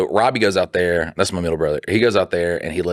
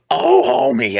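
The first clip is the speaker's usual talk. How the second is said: very high cartoon mom voice; Marge Simpson; slower than usual. Shown as a demonstration. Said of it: "try Marge Simpson".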